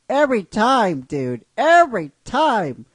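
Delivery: a fan yelling every time at the payoff; excited cheer. Retold or imitated. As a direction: cheer every time like a buddy finally vindicated. Brock cheering the proposal.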